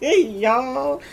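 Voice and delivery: loud, high burst of laughter into y'all. Real, not acted. Mocking the rival's basketball past to viewers: loud laughter. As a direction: laugh hard at the joke.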